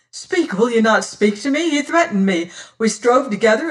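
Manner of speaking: urgent plea. Acted. Hero pleads his innocence.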